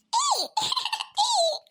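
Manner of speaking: a high, jeering laugh through the word; mocking laugh. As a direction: laugh at her as you call out. Neighbour mocks the old woman.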